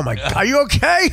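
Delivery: normal talking voice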